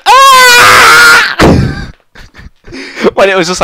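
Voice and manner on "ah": very high, loud imitated shriek; mimicking a kid yelling in a silent cinema; kid's sudden shriek. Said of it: "He's like".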